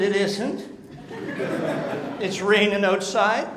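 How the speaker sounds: amused protest through a laugh; chuckling at the clerk's cheer. Retold or imitated. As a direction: laugh while pointing out the rain. Wet customer answering cheerful clerk.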